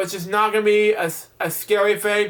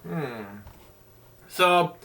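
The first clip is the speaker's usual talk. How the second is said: usual speaking voice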